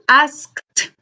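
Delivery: ASKED ending in a crisp, released T; final T sound. Shown as a demonstration. Teacher shows students the T ending, final T sound on asked. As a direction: say asked as "askt", spitting out the T. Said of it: "como T".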